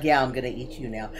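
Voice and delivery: imitating a scary monster, low and soft; creepy monster. Player retelling the monster's threat as they backed away, creepy. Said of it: "You were more scary with your words".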